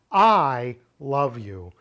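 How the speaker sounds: "I" hit loud and drawn out; stress on I. Shown as a demonstration. Teacher showing emphasis on the subject, stressed "I". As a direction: punch and stretch "I", then ease off "love you". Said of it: "put the emphasis on the first word".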